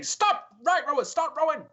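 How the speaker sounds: high, loud calls to the crew; shouted commands. Acted. Dwarf at the tiller to uneven rowers, shouting. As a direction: holler corrections down the boat.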